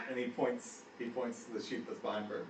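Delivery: normal talking voice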